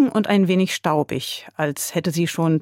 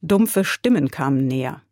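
ordinary delivery, nothing marked

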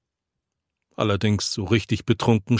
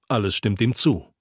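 usual speaking voice

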